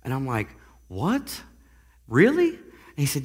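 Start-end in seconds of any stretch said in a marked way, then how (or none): 0.9-2.5 higher, louder shocked what, really; stunned disbelief. Retold or imitated. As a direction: leap up in astonished disbelief. Retold disbelief hearing about author.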